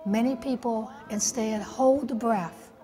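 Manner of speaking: ordinary talk, nothing marked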